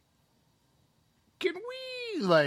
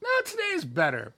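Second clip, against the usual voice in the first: mock high voice; faster than usual. Joking to his friend about skipping the session, squeaky high mock voice. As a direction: voice jumped way up high and quick, a squeaky mock reply. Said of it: "And I was like".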